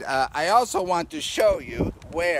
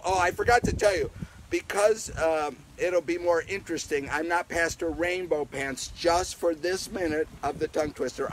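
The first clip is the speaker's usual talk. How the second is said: normal talking voice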